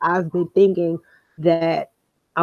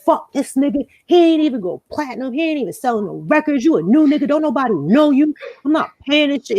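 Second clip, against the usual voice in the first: higher-pitched mock tirade; retold cussing out; 6 semitones higher than usual. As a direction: re-enact the rant, pitched up and heated. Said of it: "I went the fuck off".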